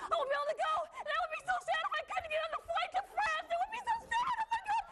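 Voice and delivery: climbing to a high-pitched wail; mounting panic. Acted. Reporter dreads missing a flight to France, panicked wail.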